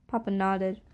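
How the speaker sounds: plain reading voice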